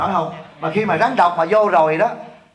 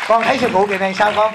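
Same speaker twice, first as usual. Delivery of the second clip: quoted disciple; 4 semitones higher, 5 dB louder than usual. Retold or imitated. Teacher voices a disciple's question.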